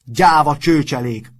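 loud, slow, high-pitched bellow; drawn-out furious yell; angry roar. A narrator's reading. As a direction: roar the insult at everyone. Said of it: "ordított Matthias".

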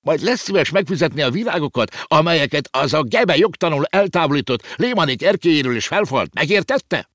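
high-pitched indignant landlord's rant; shrill, scolding tirade; Waffelbruck screeching. A narrator's reading. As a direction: screech the demand in outrage. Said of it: "rikálcsolt a kiskövér Waffelbruck úr".

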